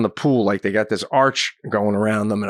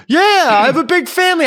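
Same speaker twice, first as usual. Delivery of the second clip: fast, high, loud comeback; defensive shout; 7 dB louder, faster than usual. Retold or imitated. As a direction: imitate a stranger shouting his excuse. Stranger yells back.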